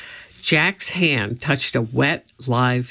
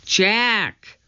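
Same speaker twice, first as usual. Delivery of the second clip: calling; 8 semitones higher than usual. A narrator's reading. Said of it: "Annie was calling from above".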